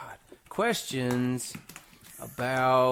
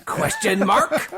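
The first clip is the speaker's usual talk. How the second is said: laughing shout; 7 semitones higher, 7 dB louder than usual. Real, not acted. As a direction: burst out laughing.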